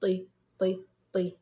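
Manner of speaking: طي as a separate emphatic "ti"; each طي on its own, with an i vowel; letter with kasra. Shown as a demonstration. Teacher showing how kasra is pronounced, separate طي. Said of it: "هذا صوت حرف الطاء بحركة الكسر".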